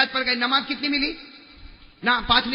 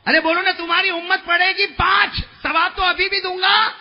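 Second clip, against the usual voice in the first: much higher and louder, proclaiming; booming divine voice; 10 semitones higher, 8 dB louder than usual. Acted. Preacher voicing God comforting the Prophet, booming. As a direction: call out God's promise over the crowd. God's reply voiced in a high shout.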